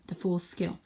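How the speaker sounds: ordinary delivery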